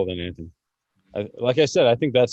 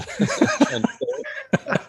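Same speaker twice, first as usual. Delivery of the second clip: laughing; much slower than usual. Real, not acted. Laughing at friend's attempt.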